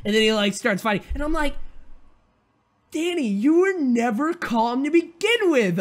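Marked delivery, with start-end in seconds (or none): 2.9-5.8 high, slow, incredulous telling-off; exasperated scolding. Acted. Viewer scolding Danny at the screen, exasperated. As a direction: stretch it out in pitched-up exasperation.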